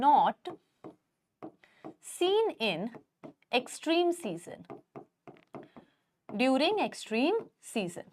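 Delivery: word by word, slowly, for students to write; slow dictation. Acted. Teacher dictating notes to her students, slow and spaced. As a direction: spell it out slowly so the class can copy.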